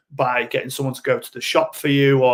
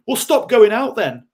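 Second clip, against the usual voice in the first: sharp, loud, higher scolding; shouting down the drinker as persecutor; 8 semitones higher, 7 dB louder than usual. Retold or imitated. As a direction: snap at the victim impatiently.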